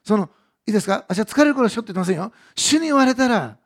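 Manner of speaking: loud, rising sermon climax; fiery preaching. Acted. Preacher urging the congregation with loud, rising joy. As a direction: preach it with full force, building. Preaching builds to full force.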